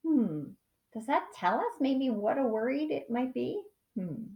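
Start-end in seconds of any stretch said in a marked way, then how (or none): none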